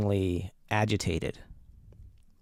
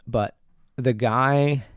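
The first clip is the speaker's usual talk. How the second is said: ordinary talk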